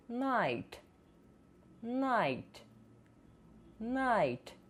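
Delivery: night said as nait; silent gh. Shown as a demonstration. Teacher models night with silent gh. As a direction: glide night without gh. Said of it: "consoantes muda no meio".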